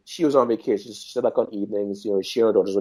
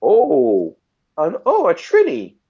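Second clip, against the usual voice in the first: excited chef; 11 semitones higher, 5 dB louder, slower than usual. Retold or imitated. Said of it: "She goes like".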